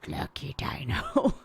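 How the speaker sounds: put-on whispered, slow, tiny toddler answer. Clark answering her mom, a slow whispered toddler voice. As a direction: breathe it slowly like a shy toddler. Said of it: "She'll go".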